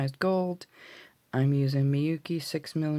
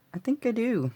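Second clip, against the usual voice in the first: much higher, falling at the end; playful high repeat; 10 semitones higher than usual. Real, not acted. Crafter reassuring viewers she got it right, playfully high.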